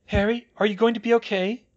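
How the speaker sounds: high, loud concerned question; anxious high-pitched query; worried Hermione. A narrator's reading. Hermione worries over Harry.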